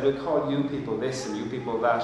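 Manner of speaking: ordinary talk, nothing marked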